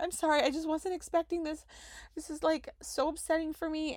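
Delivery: imitating her upset self; teary apology. Apologizing tearfully to nurse.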